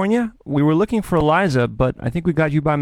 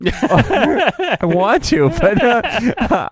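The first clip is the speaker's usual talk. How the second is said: nervous laughter; 6 semitones higher, much slower than usual. Real, not acted. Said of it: "I'm nervous now".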